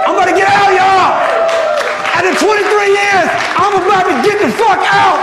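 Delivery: loud, high triumphant yell; excited shout. Real, not acted. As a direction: shout it out like you're free at last. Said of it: "excited shout of getting out".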